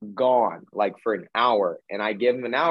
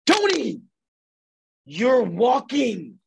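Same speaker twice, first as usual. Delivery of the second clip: much higher, stretched out in astonishment; amazed cry; 11 semitones higher, 4 dB louder, much slower than usual. Retold or imitated. Healer amazed to see his client walking, high astonished cry. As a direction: gasp it out like you can't believe it.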